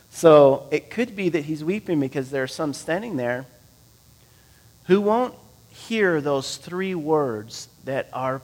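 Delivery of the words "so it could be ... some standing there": ordinary talk, nothing marked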